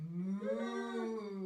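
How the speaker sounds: a lightly voiced mock moo; playful cow noise; funny cow moo. Shown as a demonstration. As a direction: moo like a cow to amuse the baby. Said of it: "some nice funny noises".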